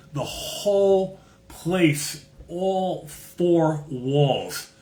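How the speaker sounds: very slow, high-pitched, every word stretched; drawn-out emphasis. Real, not acted. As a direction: spell out the sheer scale word by word. Awed emphasis on the shop's stock.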